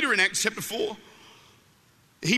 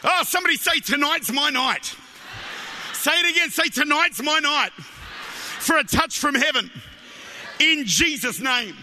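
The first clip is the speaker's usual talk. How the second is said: loud, higher, stretched rallying cries; full-force preaching; 5 semitones higher, 4 dB louder than usual. Real, not acted. Preacher urging the congregation to say tonight's my night, full-force preaching. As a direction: rouse the crowd with drawn-out loud phrases.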